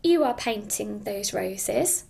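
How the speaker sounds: ordinary delivery, nothing marked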